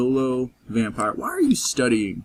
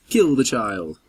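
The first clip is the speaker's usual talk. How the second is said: menacing command; slower than usual. Pretend. Commanding the vampire.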